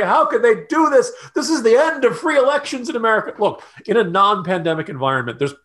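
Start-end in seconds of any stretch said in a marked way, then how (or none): 0.0-3.3 high-pitched overblown outrage; hammed-up political alarm; mock hysteria. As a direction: wail it like the sky is falling. Mocks alarmed reaction to voting law.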